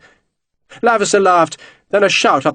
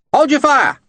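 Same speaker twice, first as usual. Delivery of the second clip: called order; 13 semitones higher than usual. A narrator's reading. Officer calling out over the gunfire to cease shooting. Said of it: "a voice called".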